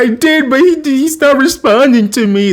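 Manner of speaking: high whiny fake sobbing; hammed-up teary plea; mock crying. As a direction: wail like a heartbroken girl. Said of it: "and she's crying".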